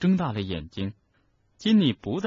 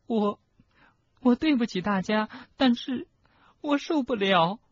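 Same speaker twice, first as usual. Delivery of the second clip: put-on high, tearful, faltering girl's voice; feigned sobbing; 14 semitones higher than usual. Schoolgirl pretending to break down before friends, sobbing. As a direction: apologise through put-on tears. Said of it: "赫敏在用双手捂着脸拼命呜咽".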